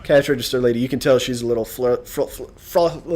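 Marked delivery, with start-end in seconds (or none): 1.6-3.1 mock flustered stutter. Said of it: "I can't even talk 'cause I'm so flustered".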